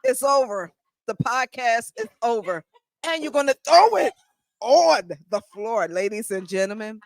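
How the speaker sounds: high-pitched, theatrical exasperation; mock outrage. Host jokingly ending the podcast over a thrown box, mock outrage. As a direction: play up the scandal for the audience.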